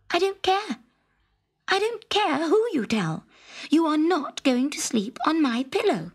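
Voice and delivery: high, stubborn refusal; defiant princess. A narrator's reading. Princess defying the frog's threat to tell.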